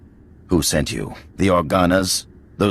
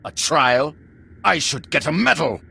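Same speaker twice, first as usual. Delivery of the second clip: indignant scoff; 8 semitones higher than usual. Acted. Cornered usurper scoffs at trial.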